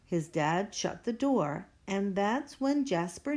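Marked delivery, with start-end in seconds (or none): none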